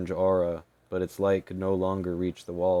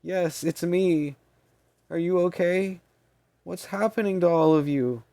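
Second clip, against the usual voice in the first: anxious; 11 semitones higher, 6 dB louder than usual. Acted. Maney anxious over dying fairy.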